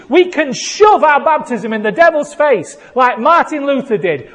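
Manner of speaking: very loud, high-pitched rallying sermon; shouted revival proclamation; full-force preaching. Real, not acted. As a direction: whip up the congregation at the top of your voice.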